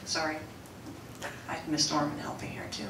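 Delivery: ordinary talk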